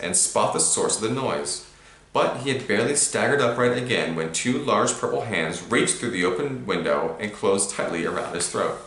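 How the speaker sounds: ordinary delivery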